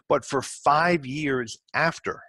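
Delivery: five years after drawn out and heavy; stern, slowed-down client warning; slow emphatic warning. Shown as a demonstration. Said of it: "you have to understand something".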